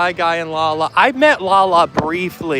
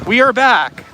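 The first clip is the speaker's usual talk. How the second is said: relieved; 6 semitones higher, 6 dB louder than usual. Real, not acted. Stream reconnected after refreshing.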